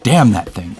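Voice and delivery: ordinary talk, nothing marked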